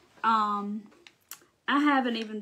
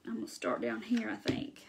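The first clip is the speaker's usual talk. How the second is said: quiet, near-whispered aside; muttering to self; 3 semitones lower, 9 dB quieter than usual. Real, not acted. Crafter working out where to start, muttering to self. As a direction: half-whisper it to yourself as you work. Talking to herself while crafting.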